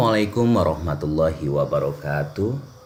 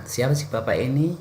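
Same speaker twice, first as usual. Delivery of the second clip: example question; 7 semitones higher than usual. Shown as a demonstration. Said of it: "contoh kalimat".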